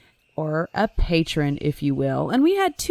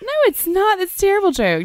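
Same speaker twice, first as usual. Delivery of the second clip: high, loud indignant protest; 12 semitones higher, 5 dB louder, faster than usual. Real, not acted. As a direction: protest in outrage.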